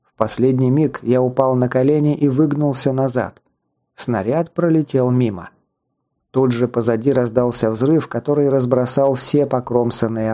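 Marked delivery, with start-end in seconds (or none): none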